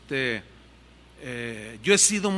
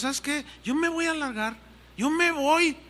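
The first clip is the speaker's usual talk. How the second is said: raised, higher frustrated outburst; fed up and ready to quit, voice pitched up; angry complaint to wife; 9 semitones higher than usual. Retold or imitated. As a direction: pastor telling wife he is leaving, angrily. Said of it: "Yo estaba enojado".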